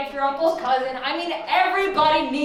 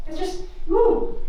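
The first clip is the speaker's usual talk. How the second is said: mock party cheer; 8 semitones higher, 3 dB louder, slower than usual. Celebrating party.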